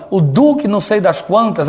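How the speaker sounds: ordinary talk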